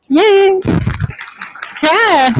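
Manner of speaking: high excited "yay"; cheering. Real, not acted. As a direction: celebrate with the room. Cheering authors.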